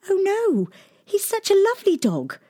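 very high, pleading little princess; cartoon princess. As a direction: beg sweetly in a tiny high voice.

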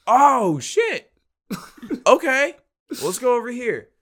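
loud, high startled yelp. Retold or imitated. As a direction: jump out of your skin then steady. Lance startled, buyer is him.

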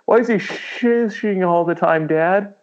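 put-on high, whiny child's question; puzzled kid. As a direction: ask Dad in a put-on little kid's voice. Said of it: "Every kid's gonna be like".

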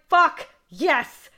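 loud, high punched exclamations; excited cheer. Retold or imitated. Fan reliving her favorite moment, thrilled. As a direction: shout each word in triumph.

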